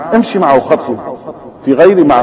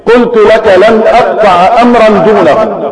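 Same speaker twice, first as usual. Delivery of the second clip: sharp retort; 5 semitones higher than usual. Acted. Old man refuses his son sharply, curt anger. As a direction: snap back at the son. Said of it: "فقال الشيخ في حدة".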